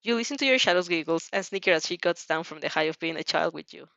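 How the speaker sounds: ordinary delivery, nothing marked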